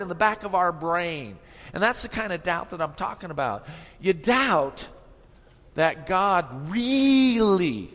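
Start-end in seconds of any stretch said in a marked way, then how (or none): 5.7-7.9 that God REALLY, slow and loud on really; stress on really. Shown as a demonstration. Pastor stressing to the congregation that God really cares, slow heavy stress on really. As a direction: lean hard on the word really, drawn out. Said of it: "I'm emphasizing that".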